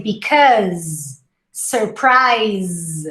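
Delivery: because and phase ending on a buzzed z; final Z sound. Shown as a demonstration. Teacher models because and phase ending in Z. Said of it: "the final pronunciation is a Z".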